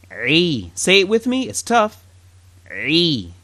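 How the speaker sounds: "Ri" squeezed from the throat as an ayn; ayn throat sound. Shown as a demonstration. Teacher models the tough ayn sound from the throat. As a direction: constrict the throat on "Ri". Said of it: "and you get ri".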